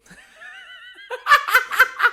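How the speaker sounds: loud high burst of laughter; big laugh. Real, not acted. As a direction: crack up loudly.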